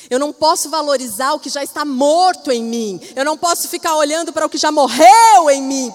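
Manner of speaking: preacher rising to full force; preaching. Acted. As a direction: drive it home from the pulpit. Preaching climax.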